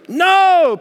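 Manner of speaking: high, loud protesting No. Acted. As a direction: burst out No in protest. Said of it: "Peter protested".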